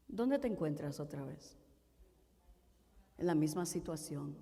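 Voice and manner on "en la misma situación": normal talking voice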